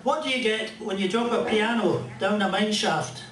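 ordinary delivery

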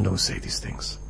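hushed, breathy whispered rebuke; whispered warning. Acted. Qadi warns the disciple in a whisper.

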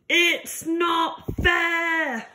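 hammed-up tantrum line; mock shout. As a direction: stretch it out like a sulky child. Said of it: "and shout".

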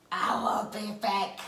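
slow, low robotic action-hero line; Terminator voice. Pretend. Player acting out a Terminator clue, slow and low. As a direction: drawl it out like a movie cyborg. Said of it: "Luana will terminate?".

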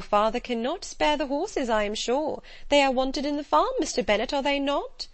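a fussing wife who wants the horses kept home; Mrs Bennet's voice. Acted. Mrs Bennet presses her husband.

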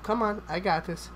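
ordinary talk, nothing marked

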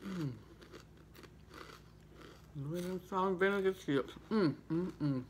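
chewing while talking; eating snacks mid-sentence; mouth full. Real, not acted. Seen 3: Eats chip just before, mouth moving chewing, then bites sandwich.

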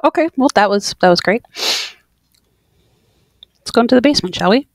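loud, eating spicy wings; mouth full of hot food. Real, not acted. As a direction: talk through a burning mouth. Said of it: "my mouth is on fire".

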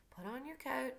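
lightly voiced absent-minded father; Atticus, dreamy. A narrator's reading. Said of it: "said Atticus dreamily".

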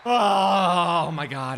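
loud, high wail falling away; anguished cry. Real, not acted. Gamer failing to stop fourth down, despairing cry. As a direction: wail it out, then sink down.

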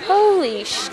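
high-pitched stunned exclamation; retold shock. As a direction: act out disbelief. Retold shock during the quarrel.

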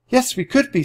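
put-on high, eager girl's voice; a girl eagerly claiming to be a paratrooper; Annie's voice. A narrator's reading. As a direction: blurt it out brightly.

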